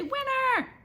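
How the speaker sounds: high triumphant shout of the word; gloating cry. A narrator's reading. Boy crowing his win at the narrator, gleeful. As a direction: crow it like a champion.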